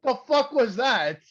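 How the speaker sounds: high, loud stunned outburst; can't believe what he just saw; shocked disbelief. Real, not acted. Podcaster reacting to a botched slam, stunned high outburst.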